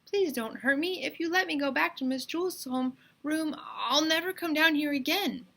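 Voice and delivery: high pleading little voice; boy begs the men to let him go, high and pleading; chirpy plea. A narrator's reading. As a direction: beg in a small high chirp. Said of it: "chirped Myron".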